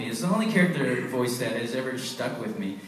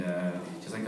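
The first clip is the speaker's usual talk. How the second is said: ordinary talk, nothing marked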